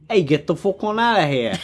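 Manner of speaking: mildly hammed-up shooing yokel, fast; lightly voiced local chasing a dog. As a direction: shoo the dog off, a touch rustic. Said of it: "everybody around the moors is like".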